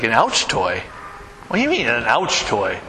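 low, puzzled question back; puzzled dad. Retold or imitated. Dad puzzled by his daughter in the toy aisle, low and baffled. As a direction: sound honestly baffled by the phrase.

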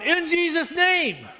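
high, slow, called-out reminder; a church lady calling from the back pew; shouted out. Retold or imitated. A lady at a funeral calls out to the pastor, high and slow. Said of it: "a lady from the back shouted out".

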